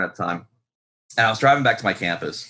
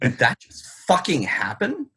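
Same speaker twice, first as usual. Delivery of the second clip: slow, dazed disbelief; stunned disbelief; 6 semitones higher than usual. Retold or imitated. Shaken driver after the cop leaves, slow dazed disbelief. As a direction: let it sink in slowly. Shaking after being let go.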